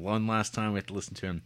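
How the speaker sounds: ordinary talk, nothing marked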